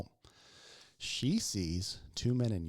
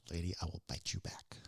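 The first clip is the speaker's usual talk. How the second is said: low, voiceless menace; mad whispered threat; 6 semitones lower, 7 dB quieter than usual. Retold or imitated. Fed-up EMT to patient who threatens biting, menacing whisper. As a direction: whisper it as a retold warning. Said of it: "I'm getting mad".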